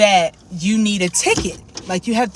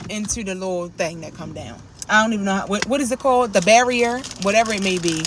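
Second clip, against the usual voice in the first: ordinary delivery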